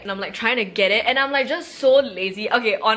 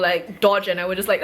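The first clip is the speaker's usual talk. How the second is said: usual speaking voice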